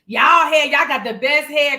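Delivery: playful loud retelling; loud quoted exclamation. Retold or imitated. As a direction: exclaim it like a hyped-up friend. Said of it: "D S used to always say".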